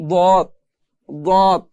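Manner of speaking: দত with tongue edge on upper side teeth, pressed; demo letter. Shown as a demonstration. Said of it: "গোড়ার সঙ্গে লাগিয়ে পড়তে হয়". (জিহ্বার কোণা দাঁতের গোড়ায় লাগিয়ে দত)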